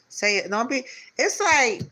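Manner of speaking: high, loud urging; voice going up. Real, not acted. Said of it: "that's when my tone of my voice will go up".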